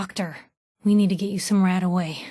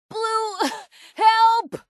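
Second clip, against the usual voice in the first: desperate call for help; 11 semitones higher than usual. Acted. Wounded companion calling Blue for help, desperate. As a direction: cry out to Blue for rescue.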